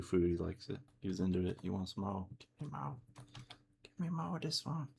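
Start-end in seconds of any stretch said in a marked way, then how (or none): 2.4-4.9 dog voice. Pretend. Voicing the dog wanting food.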